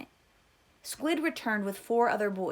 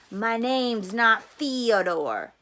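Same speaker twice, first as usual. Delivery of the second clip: slow, much louder threat; angry; 10 dB louder, much slower than usual. A narrator's reading. Boy grabbing Stanley by the collar, slow loud anger. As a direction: growl it out slowly, much louder.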